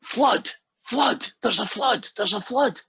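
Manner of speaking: high acted warning cry; high repeated mock call-out; mock alarm. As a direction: raise a pretend alarm, pitch leaping up. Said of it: "the Scots guy goes".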